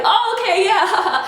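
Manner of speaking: high-pitched, over-keen mock agreement; eager agreement. Man accepting a pound instead of a hug, eager. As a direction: imitate him going along, voice jumping up.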